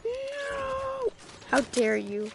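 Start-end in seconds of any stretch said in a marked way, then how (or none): 0.0-1.1 high despairing wail. Real, not acted. Player pleading as he dies.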